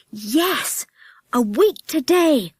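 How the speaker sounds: high, eager cry of delight; children crying out in joy, stretched and high; cried out together. A narrator's reading. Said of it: "cried everyone".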